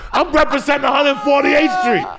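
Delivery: loud, high, pumped-up yelling; hyped-up shouting. Retold or imitated. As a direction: build up to a fight. Hyped-up retold fight resolve.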